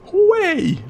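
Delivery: high squeaky hooray; mock cheer. Mock celebration of curse.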